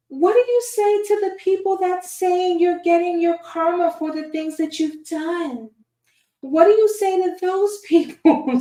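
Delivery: high, sing-song church lady grilling him; Bible Lady impression. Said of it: "my best, uh, Bible lady impression".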